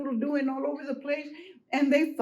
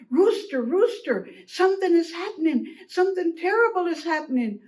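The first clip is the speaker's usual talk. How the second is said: alarmed hen, raised pitch; urgent alarm; 4 semitones higher, 3 dB louder than usual. Acted. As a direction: call out with urgent news.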